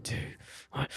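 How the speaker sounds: soft hushed countdown. Retold or imitated. Frozen friend counting down before escaping the hand, hushed. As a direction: count down under your breath. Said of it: "And then they go".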